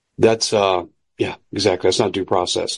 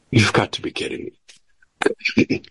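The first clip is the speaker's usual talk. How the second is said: whispered disbelief; incredulous whisper; 3 dB quieter than usual. Real, not acted. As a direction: scoff it under your breath. Scoffs at democracy.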